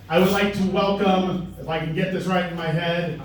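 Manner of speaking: normal talking voice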